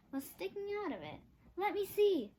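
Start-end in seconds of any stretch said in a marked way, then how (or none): 1.5-2.3 eager. A narrator's reading. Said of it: "said Jack".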